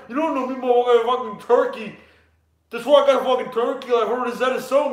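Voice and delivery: mock rapper voice, loud and pushed; everyday rapper. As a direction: hype it up like a brash rapper. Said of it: "That's everyday rappers for you".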